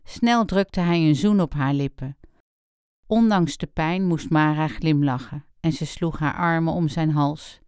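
plain reading voice

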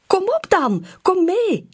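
hop on the spot and hurry her along; urging eagerly. A narrator's reading. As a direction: pup bouncing to get his friend outside. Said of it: "dringt hij aan".

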